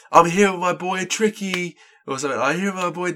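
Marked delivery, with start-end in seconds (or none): 0.1-1.8 high, hyped hype-man voice, much higher; hyped-up rapper. Retold or imitated. Rapper at a film Q&A hyping his friend, high and excited. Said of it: "he went". 2.4-3.1 high, hyped hype-man voice, much higher; hyped-up rapper. Retold or imitated. Rapper at a film Q&A hyping his friend, high and excited. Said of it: "he went".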